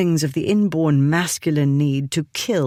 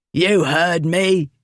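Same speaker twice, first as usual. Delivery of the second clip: put-on surly drunkard; 3 semitones higher, slower than usual. A narrator's reading. The drunkard squaring up to the Duke, slow and belligerent. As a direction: sneer it like a drunken bully.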